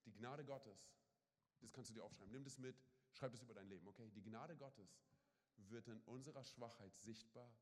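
low and soft, slowing at the end; intimate appeal. Acted. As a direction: speak close to each listener.

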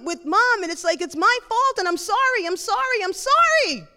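high, loud outpouring of guilt; frantic apology climbing to a peak; distraught apology. Retold or imitated. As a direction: beg forgiveness, stumbling and building. Acted outpouring of guilt to brother.

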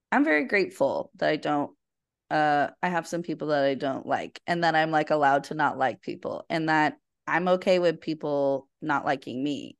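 ordinary talk

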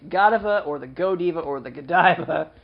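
Godiva three ways: GOT-uh-vuh, go-DEE-vuh, guh-DIE-vuh; three pronunciations. Shown as a demonstration. Host wrapping up the review, Godiva pronounced GOT-uh-vuh, go-DEE-vuh, guh-DIE-vuh. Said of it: "the company is pronounced Godiva".

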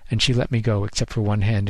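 ordinary delivery, nothing marked

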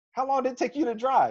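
amazed question. Retold or imitated. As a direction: pitch it way up in retold amazement. Coach amazed at the drive, retold.

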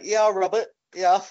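high comic call; put-on high chirp; acted calling out. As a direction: lightly mimic hailing someone. Acting out a swing ball scene.

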